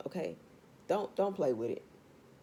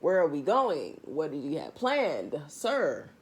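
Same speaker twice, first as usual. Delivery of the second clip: ordinary talk, nothing marked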